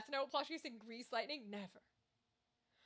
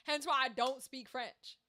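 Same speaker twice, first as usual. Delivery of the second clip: ordinary talk, nothing marked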